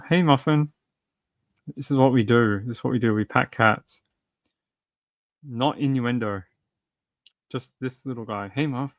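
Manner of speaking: soft pet talk. Real, not acted. Streamer murmuring softly to the cat while patting. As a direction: hush it gently to the pet.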